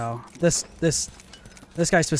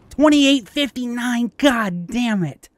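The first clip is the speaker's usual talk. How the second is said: loud, slow disbelieving exclaim; excited; 8 semitones higher, 4 dB louder, slower than usual. Real, not acted. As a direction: stretch it out in amazement. Reacting to high damage score.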